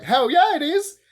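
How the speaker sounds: high, loud hell yeah; rising fan cheer; excited cheer. Real, not acted. Fan cheering the love story.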